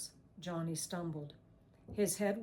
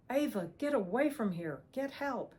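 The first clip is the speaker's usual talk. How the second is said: urgent plea; 5 semitones higher, 4 dB louder than usual. A narrator's reading. Johnny urges her away.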